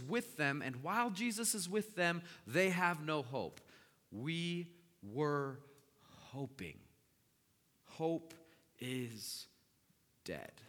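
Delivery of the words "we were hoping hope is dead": word by word, long pauses; halting, weighted delivery, dropping away at the end; deliberate emphasis. Real, not acted. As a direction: let each word land on its own. Preacher dwells on lost hope.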